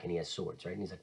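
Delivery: ordinary talk, nothing marked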